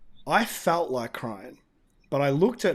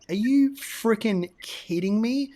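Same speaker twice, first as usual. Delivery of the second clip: higher and louder, slow disbelief; incredulous; 6 semitones higher, 4 dB louder than usual. Retold or imitated. Telling the host about the interview, incredulous. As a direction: scoff at how absurd it is. Outraged reaction to soft interview.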